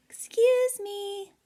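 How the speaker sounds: high, polite little voice; little cloud voice. Acted. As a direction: squeeze past politely as a tiny cloud. Tiny cloud squeezing past.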